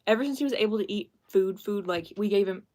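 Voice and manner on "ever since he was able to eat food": ordinary talk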